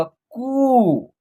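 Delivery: कू with the ऊ held long; long vowel. Shown as a demonstration. Hindi teacher demonstrating the long ऊ, कू drawn out. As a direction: stretch the vowel in कू. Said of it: "long तरीके से होगा".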